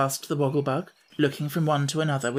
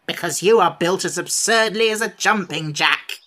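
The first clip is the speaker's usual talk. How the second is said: louder mocking sneer; 4 semitones higher, 5 dB louder than usual. A narrator's reading. Sawhorse mocking the Pumpkinhead's build, sneering. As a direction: look down on him. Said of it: "sneered the horse".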